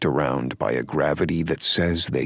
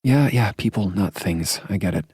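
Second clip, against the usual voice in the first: higher, lightly voiced woman; female character voice; 8 semitones higher, 4 dB louder than usual. A narrator's reading. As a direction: play her teasing and light. Heroine's dialogue, higher voice.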